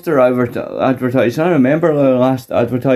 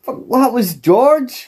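higher and slower, disbelieving; surprised; 10 semitones higher, slower than usual. Real, not acted. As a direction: gasp it out in disbelief. Shocked discovery.

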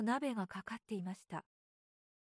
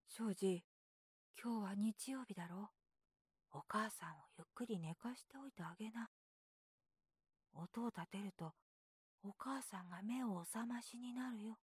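hushed brother; 11 dB quieter than usual. A narrator's reading. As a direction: keep it down, mother's asleep. Half-asleep brother hushes little boy.

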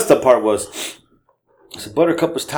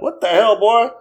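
startled yell; 11 semitones higher than usual. Retold or imitated. Startled grandpa woken by boy.

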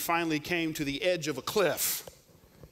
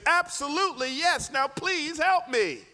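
frustrated plea; 9 semitones higher than usual. Acted.

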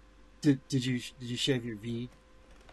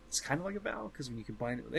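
fading softer, unfinished; trailing off; 10 dB quieter, faster than usual. Real, not acted. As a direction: let the thought trail away. Explanation fades out.

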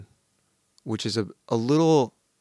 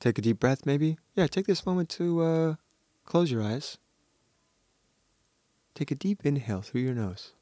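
guided meditation; 4 semitones higher, 3 dB quieter than usual. Pretend. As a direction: lead them gently into a breathing exercise. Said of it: "leading a meditation".

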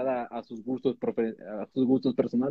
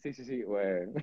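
laughing; 3 dB louder than usual. Real, not acted. Laughing at own fresa talk.

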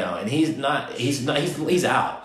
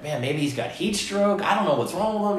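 freaking out; 9 semitones higher than usual. Retold or imitated. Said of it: "I'm like".

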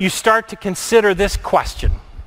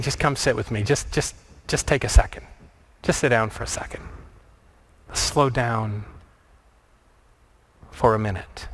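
soft, low, gentle plea; pleading; 8 semitones lower, 7 dB quieter than usual. Acted. Jesus asking the distracted listener to come sit, softly pleading. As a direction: plead quietly, much lower and softer, with pauses. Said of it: "the pleading voice of Jesus saying".

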